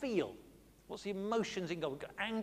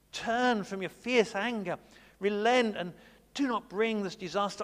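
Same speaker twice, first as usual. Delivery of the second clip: pleading Moses; 4 semitones higher, 7 dB louder than usual. Retold or imitated. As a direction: beg God to relent.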